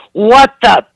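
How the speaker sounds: high, loud hammed-up tirade; mother's outraged rant. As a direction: rail at everyone like an indignant mom. Mother scolds hospital staff.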